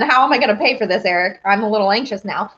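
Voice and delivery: normal talking voice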